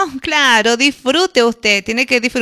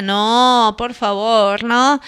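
usual speaking voice

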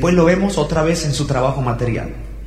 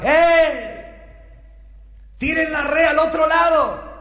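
high-pitched distant call, no extra volume; 12 semitones higher, slower than usual. Acted. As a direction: call across the water to fishermen. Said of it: "comenzó a gritar". (grito agudo llamando desde lejos)

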